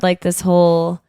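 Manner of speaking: ordinary talk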